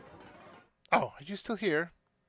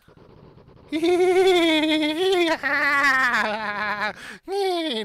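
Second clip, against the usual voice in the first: shrieking laugh; 10 semitones higher, 9 dB louder than usual. Acted.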